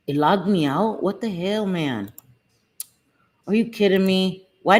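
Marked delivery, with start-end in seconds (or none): none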